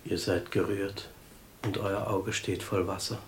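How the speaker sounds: low and hushed; hushed sympathy. Acted. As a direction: speak gently to someone in tears. Moved listener observes the teller's tears.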